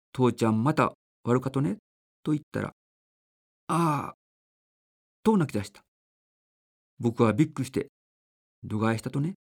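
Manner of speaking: gentle storybook reading voice; measured, earnest reading of a child's essay; story narration. A narrator's reading. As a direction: read it aloud like a radio story. Reads a child's story aloud.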